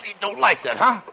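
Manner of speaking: very high, loud vicious yell; savage taunt. Acted. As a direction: strike while taunting. Husband strikes the boss.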